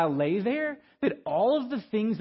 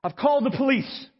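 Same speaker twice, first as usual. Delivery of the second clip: higher-pitched retold warning; announced out loud; 5 semitones higher than usual. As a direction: call a warning to an unseen intruder. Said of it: "I say this out loud".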